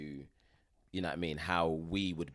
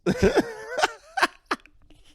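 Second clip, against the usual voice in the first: high squealing laugh; 9 dB louder than usual. Real, not acted. Laughing at own towel deal.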